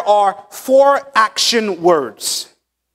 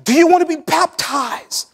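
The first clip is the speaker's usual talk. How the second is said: lightly voiced eager recruiter, higher; higher coaxing call to the kids; mock coaxing to kids; 7 semitones higher than usual. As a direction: sell it to the children, half-playful. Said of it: "and say".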